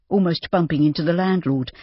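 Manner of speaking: usual speaking voice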